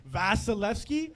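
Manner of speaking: normal talking voice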